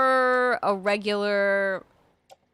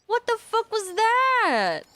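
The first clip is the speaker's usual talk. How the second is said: startled disbelief; 11 semitones higher, much slower than usual. Real, not acted. Startled by something in game.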